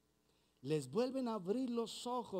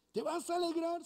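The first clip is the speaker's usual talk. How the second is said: higher, louder disbelieving question; can't believe what he hears; incredulous question; 9 semitones higher, 4 dB louder than usual. Acted. Doubting believer questioning God, higher and incredulous.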